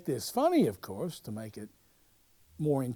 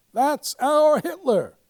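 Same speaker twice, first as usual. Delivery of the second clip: slow, loud, high exclaim; triumphant discovery; excited; 12 semitones higher, 9 dB louder, slower than usual. Retold or imitated. Producer leaping up at the audition, loud excited shout. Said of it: "Max jumps up and says".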